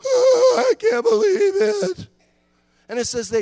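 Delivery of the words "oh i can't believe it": high, loud, slow hammed-up wail; mock wailing. Preacher mocking the weeping Israelites, hammed-up wail. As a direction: wail it like a caught crowd playing sorry. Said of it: "here's what they do".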